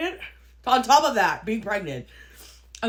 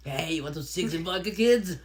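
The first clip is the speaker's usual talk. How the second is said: put-on Russian accent; 6 semitones lower than usual. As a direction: offer vodka in a hint of a Russian accent. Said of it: "I like your Russian accent".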